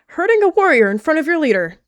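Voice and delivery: loud, high mock scolding; mock outrage. Cat mock scolding her sister, loud and high. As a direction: play up fake indignation.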